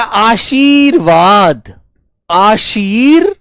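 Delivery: आशीर्वाद stretched out slowly, syllable by syllable; slow dictation. Shown as a demonstration. Teacher slowly sounding out आशीर्वाद for the class. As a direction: drag out आशीर so the र is heard. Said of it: "रे यहां पर बोला जा रहा है".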